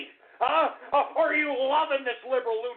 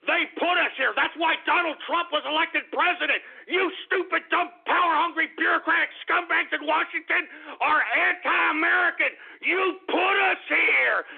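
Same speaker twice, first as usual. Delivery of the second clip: indignant, raised-pitch rant; angry accusation; 3 semitones higher than usual. Real, not acted. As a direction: lay the blame hard. Furious accusation at Washington bureaucrats.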